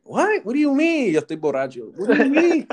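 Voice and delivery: high, loud baffled what; mock bewildered questioning; drunk confusion. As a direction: act stunned and lost, a bit drunk. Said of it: "And I'm like".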